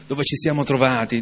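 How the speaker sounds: ordinary talk, nothing marked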